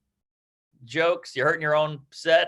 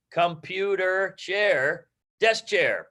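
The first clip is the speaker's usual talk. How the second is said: slow side-by-side demo; 3 semitones higher, much slower than usual. Shown as a demonstration. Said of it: "cut off two syllables".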